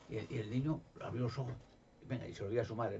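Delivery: usual speaking voice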